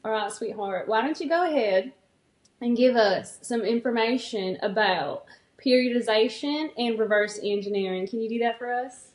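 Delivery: coaxing country sweetheart; country accent. Pretend. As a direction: hand over to a partner in a country accent. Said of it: "action steps in the country accent".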